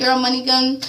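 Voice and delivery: usual speaking voice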